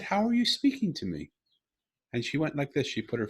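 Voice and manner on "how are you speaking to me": high, incredulous question; retold amazement. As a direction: ask it as if re-living the amazement, pitch way up. Retells his astonished question to daughter.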